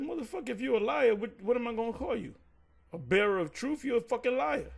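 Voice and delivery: high, heated retelling of a comeback; angry retort. Retold or imitated. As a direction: snap back at the man who called him out. Calling the guy a liar.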